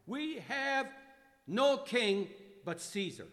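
loud, high, slow mob cry; the crowd cries out, half laughing. Acted. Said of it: "were crying".

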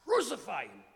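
loud, high mob yell; shout. Acted. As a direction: priests shout for crucifixion. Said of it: "the first to shout".

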